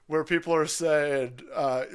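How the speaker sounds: ordinary delivery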